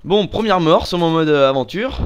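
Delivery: loud, high frustrated outburst; exasperated gamer. Real, not acted. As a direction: blurt it out, annoyed. Reacting to first death.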